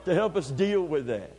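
normal talking voice

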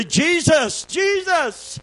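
high, strained cry of the name; desperate cry. Retold or imitated. Driver crying out as the truck flips, high and desperate. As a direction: call out in sudden terror. Crying out while grabbing wheel.

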